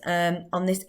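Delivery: ordinary talk, nothing marked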